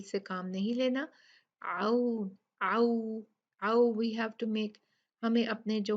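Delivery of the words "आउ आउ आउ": slow आउ, closing into a round u; rounded waw glide. Shown as a demonstration. Teacher drilling lip rounding, आउ gliding from a to u. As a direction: round the lips into the end of आउ. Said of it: "वाव के लिए गोल करने होंगे".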